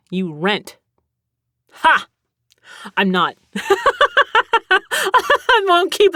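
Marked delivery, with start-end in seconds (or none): 3.5-6.1 laughing. Real, not acted. Giggling at her own joke.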